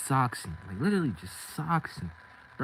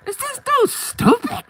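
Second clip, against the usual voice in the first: much higher and louder, slow incredulous yell; outraged shriek; 13 dB louder, slower than usual. Retold or imitated. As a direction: scream it in disbelief. Outraged at gunless cousin.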